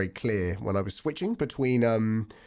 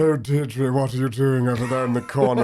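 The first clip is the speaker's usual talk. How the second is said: put-on Scottish accent; 2 semitones higher, 6 dB louder than usual. Comedian demonstrating a sudden character switch, loud Scottish accent. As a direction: call across the room in Scottish. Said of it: "I loved your Scottish accent".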